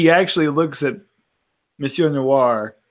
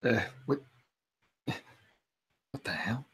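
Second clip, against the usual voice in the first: slow, hushed disbelief; stunned; 2 semitones lower, 13 dB quieter, slower than usual. Acted. Player finds button gone.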